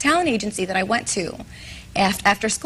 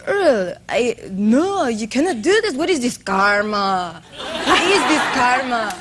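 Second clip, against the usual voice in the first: put-on a light Borat-style Russian accent, laughing; hammed-up Russian accent, slow at the end; 3 semitones higher, slower than usual. Guest to host, redoing her audition in a mild Russian accent. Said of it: "I came in with a Russian accent".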